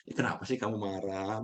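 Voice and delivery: soft, caring question to an angry person; gentle asking. Retold or imitated. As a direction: ask kindly why they're angry. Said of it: "Bukan malah ngomelin".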